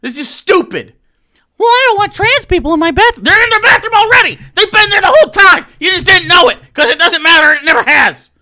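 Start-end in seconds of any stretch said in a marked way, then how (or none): 1.6-3.2 high, fast put-on voice; mocking voice. Mimicking a bathroom critic, a high mocking voice. 3.2-8.3 higher, louder, fast furious rant; angry shout. Real, not acted. Answering the bathroom complaint, a fast angry shout.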